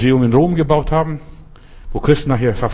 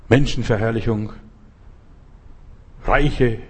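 usual speaking voice

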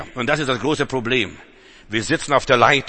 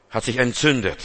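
normal talking voice

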